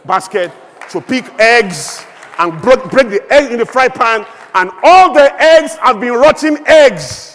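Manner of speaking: slow, building from firm to loud and high; rising shout. Real, not acted. Preacher telling the rotten-eggs parable, slow building shout. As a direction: drive the last line home, slowly and loudly. Parable reaches its angry climax.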